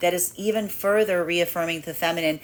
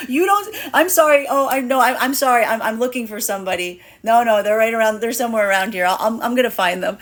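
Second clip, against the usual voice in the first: higher, louder teasing voice; playful feminine brush-off; 4 semitones higher, 5 dB louder than usual. Acted. Feminine energy brushing off a lost masculine, playful and higher. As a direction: toss it off brightly, dodging him. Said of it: "that's a feminine energy going".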